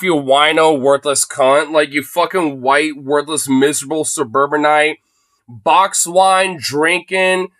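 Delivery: normal talking voice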